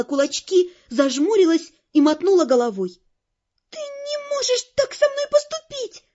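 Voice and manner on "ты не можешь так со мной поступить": very high, plaintive protest; tearful girl. A narrator's reading. Tearful heroine protests being dumped. (сквозь слёзы, очень высоко и жалобно)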